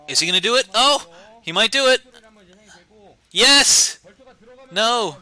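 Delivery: loud, high bursts of suspense; excited play-by-play. Real, not acted. Caster watching a risky move unfold, excited shouting. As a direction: shout each turn as it happens.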